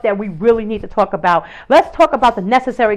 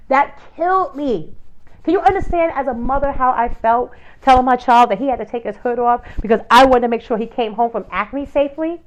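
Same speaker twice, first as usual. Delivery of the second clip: usual speaking voice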